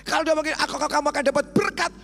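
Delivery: high, loud revival preaching; preaching at full force. Acted. Preacher rousing the congregation at full force. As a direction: rouse the balcony crowd.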